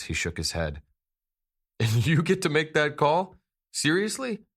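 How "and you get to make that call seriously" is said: much higher, louder disbelief; can't believe what he hears; incredulous. Acted. Z confronting Ash, incredulous.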